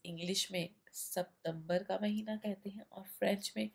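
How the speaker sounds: normal talking voice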